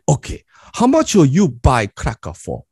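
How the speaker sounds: put-on lightly accented broken English of an immigrant dad; Korean dad accent. Dad instructs son to price crackers, lightly accented. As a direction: play the dad giving his son blunt orders.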